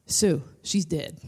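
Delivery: low, slow announcement; deadpan nurse. Retold or imitated. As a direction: break it to her slowly and low. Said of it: "a nurse comes up to me".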